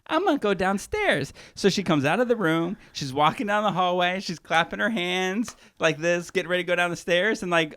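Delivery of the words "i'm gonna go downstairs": high-pitched mock musing; imitated plotting contestant. Contestant deciding to join breakfast, mock innocent. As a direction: put on a higher voice, thinking aloud. Acts Angela deciding to go downstairs.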